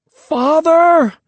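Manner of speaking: high, loud furious roar. A narrator's reading. Disgraced boy roars for his father, furious roar. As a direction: bellow for him across the village. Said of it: "I roared".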